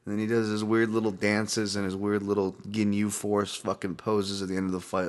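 ordinary talk, nothing marked

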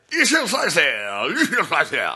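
high, loud, slow shouted hype; hyped announcer. Retold or imitated. Podcaster imitating the announcer hyping the fighter, shouting. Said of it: "He's like".

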